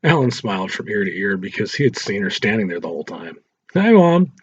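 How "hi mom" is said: higher, louder, grinning hello; cheeky greeting. Acted. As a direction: chirp it with a cheeky smile. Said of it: "He smiled and waved at Angela".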